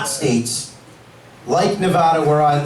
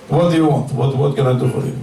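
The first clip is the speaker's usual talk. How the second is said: lightly put-on Russian accent, curt; a brusque Russian shopkeeper, lightly done; 5 semitones lower, faster than usual. Russian dispensary owner greets a customer in a mild, curt Russian accent. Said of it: "Russian dispensary owner would be".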